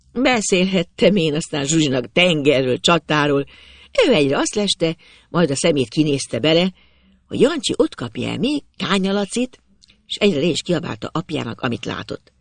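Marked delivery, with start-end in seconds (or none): none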